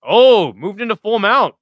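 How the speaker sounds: loud, high excited call; thrilled by the move. Real, not acted. Big scramble in fight.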